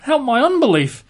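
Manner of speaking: tearful cry. Acted. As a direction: cry out through tears. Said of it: "cried out and said with tears".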